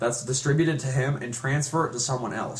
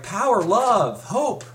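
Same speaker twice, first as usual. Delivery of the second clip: slow, high, drawn-out slogan; mock heroic slogan; 9 semitones higher, 4 dB louder, much slower than usual. Mocking the hero's slogan, slow and high. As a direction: stretch each word like a cheesy hero. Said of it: "it's like".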